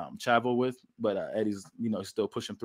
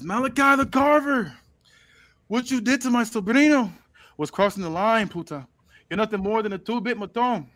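Eddie Guerrero impression; 9 semitones higher, 6 dB louder than usual. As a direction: call him out like an angry luchador uncle. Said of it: "is this Eddie?".

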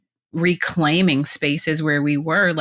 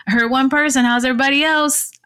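higher-pitched teasing call to the class; joking class instructor; 6 semitones higher, faster than usual. Retold or imitated. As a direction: playfully badger the whole class. Joking instructor addressing her class.

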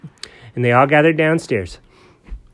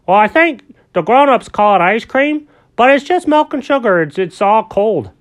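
higher, louder friendly grown-up character; Alfred's kindly voice; 10 semitones higher, 8 dB louder than usual. Acted. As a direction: talk brightly to a little buddy.

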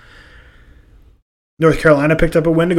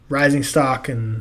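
normal talking voice

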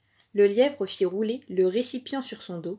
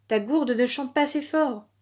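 raised, higher-pitched complaint; displeased cry; 5 semitones higher, 4 dB louder than usual. A narrator's reading. Villagers protesting about the silent gourd, crying out displeased. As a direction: heckle like an unhappy villager. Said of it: "s'écriaient les villageois mécontents".

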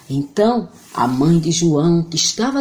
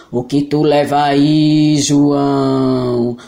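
voz grave e muito lenta de monstro; friendly monster voice; 4 semitones lower, much slower than usual. Acted. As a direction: monstro calmo, arrastado. (voz de monstro)

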